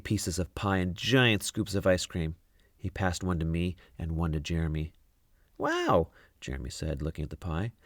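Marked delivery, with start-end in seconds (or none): 5.6-6.1 put-on much higher, amazed boy; wow. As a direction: gasp it out in awe. Jeremy sees pie.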